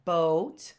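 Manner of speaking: "boat" with a long oh vowel. Shown as a demonstration. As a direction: hold the oh in "boat", lifting at the end. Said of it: "for the long O sound".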